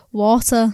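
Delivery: WATER as if spelled with an O; "water" as "wor-ter"; O-sounding water. Shown as a demonstration. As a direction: round the first vowel of "water" like an O. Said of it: "it's spelled with an O".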